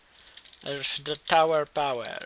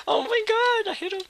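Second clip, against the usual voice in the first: much higher squealing laugh into oh my god; excited squeal; 7 dB louder than usual. Real, not acted. Gamer celebrating a lucky hit on his rival, squealing. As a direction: shriek of delight at the hit. Lands a shot on opponent.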